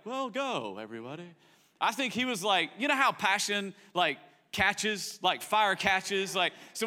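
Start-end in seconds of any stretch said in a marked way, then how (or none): 0.1-1.3 unenthused go. Retold or imitated. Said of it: "I don't think it was like".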